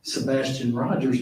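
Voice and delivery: normal talking voice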